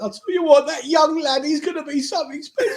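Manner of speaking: high-pitched mock weeping; hammed-up crying with pride; imitated tearful fan. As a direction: imitate an older fan choking up, laughter creeping in. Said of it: "old Man United fan started crying".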